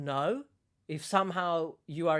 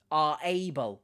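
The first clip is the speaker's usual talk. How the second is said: ordinary talk, nothing marked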